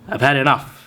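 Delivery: ordinary delivery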